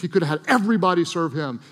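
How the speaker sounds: usual speaking voice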